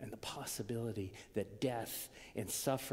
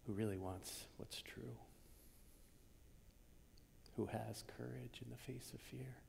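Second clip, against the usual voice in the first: soft, intimate whisper; 10 dB quieter than usual. Acted. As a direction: draw the congregation in with a hush. Reverent praise of Thomas.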